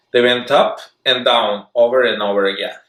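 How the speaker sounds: ordinary delivery, nothing marked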